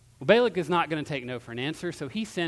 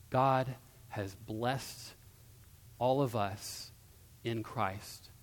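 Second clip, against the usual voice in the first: slow, hushed, low declaration; solemn preaching close; 5 semitones lower, 8 dB quieter than usual. Real, not acted. Preacher assures congregation of unchangeable blessing, slow and soft. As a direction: let each phrase settle with long pauses.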